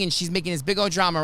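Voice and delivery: normal talking voice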